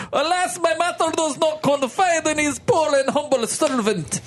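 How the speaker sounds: put-on high hammy Russian accent. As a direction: play the servant in a thick Russian accent. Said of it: "Russian now".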